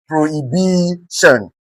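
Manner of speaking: soft, slow prohibition with a long BI; prohibition, stretched on its third syllable; stress on BI. Shown as a demonstration. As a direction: hold the BI of prohibition, quietly. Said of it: "B I being stressed".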